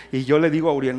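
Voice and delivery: ordinary delivery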